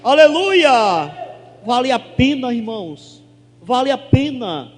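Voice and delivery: loud shouted hallelujahs, glória stretched slow; preaching at full force. Real, not acted. Pastor celebrating the healing with the congregation, shouting praise. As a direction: shout praise to the whole church.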